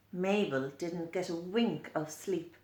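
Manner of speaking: ordinary delivery, nothing marked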